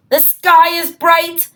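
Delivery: ordinary delivery, nothing marked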